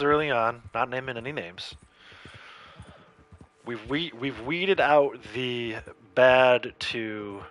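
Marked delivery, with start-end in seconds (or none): 3.6-7.4 very slow, measured qualification; careful rewording. Real, not acted. Streamer diplomatically amending a claim about his group, careful and slow. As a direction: pick each word slowly and carefully.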